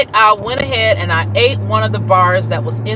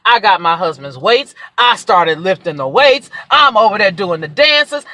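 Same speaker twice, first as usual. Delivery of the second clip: ordinary talk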